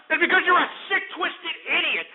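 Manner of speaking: high-pitched, indignant insult; angry outburst. Real, not acted. Host berating brony callers, angry outburst.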